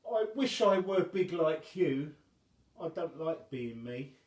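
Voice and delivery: put-on high, loud small-boy voice; a child wishing he were bigger; sad little boy. The boy telling Wobbling his wish, high and sad.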